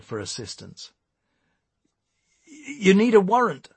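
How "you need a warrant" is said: loud, high nervous objection. A narrator's reading. Eric objecting to a vehicle search, nervously. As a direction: object in a jittery, raised voice. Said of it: "said Eric nervously".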